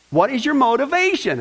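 ordinary talk, nothing marked

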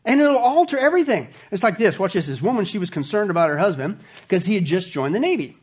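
ordinary talk

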